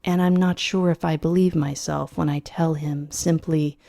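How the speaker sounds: ordinary delivery, nothing marked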